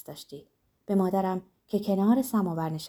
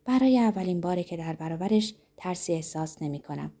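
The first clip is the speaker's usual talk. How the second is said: usual speaking voice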